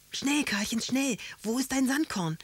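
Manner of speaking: high-pitched, pressing plea; urgent alarm. A narrator's reading. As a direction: hurry them along in a panic. Grandfather urges boy as cat appears.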